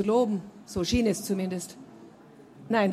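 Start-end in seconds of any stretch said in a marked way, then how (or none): none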